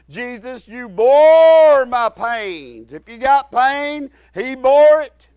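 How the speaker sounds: loud, drawn-out swell on "bore"; fervent preaching. Retold or imitated. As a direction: cry out the declaration like a preacher. Preaches retold prayer at climax.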